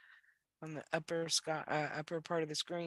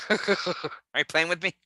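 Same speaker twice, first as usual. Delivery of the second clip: laughing; 2 semitones higher, 11 dB louder, slower than usual. Real, not acted. Laughs, suspects friend is teasing.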